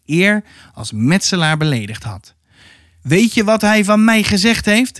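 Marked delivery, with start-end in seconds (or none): 3.0-5.0 higher, calling indignantly to Momo; narrated indignant call. A narrator's reading. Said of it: "riep hij tegen Momo".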